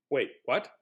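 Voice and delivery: ordinary delivery, nothing marked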